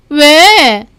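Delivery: high, loud, drawn-out "what?"; snappish sister. Acted. Sister to her angry brother at the door, snappish.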